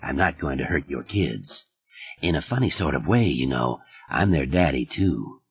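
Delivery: calm, smug menace kept quiet; Stark's voice. A narrator's reading. Stark taunting Liz about her children, low smug menace.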